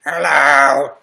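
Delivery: a hint of a squeaky wife; wife Janice voice. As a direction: pretend wife greeting the fans. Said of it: "She's got a very interesting voice".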